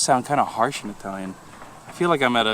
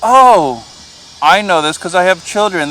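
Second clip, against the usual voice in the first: ordinary talk, nothing marked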